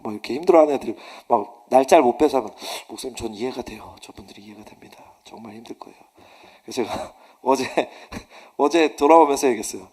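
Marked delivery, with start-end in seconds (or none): 2.9-6.0 hushed, low, understanding; soft sympathy. Retold or imitated. Junior pastor sympathizing with his senior pastor, hushed. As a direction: say it softly and gently.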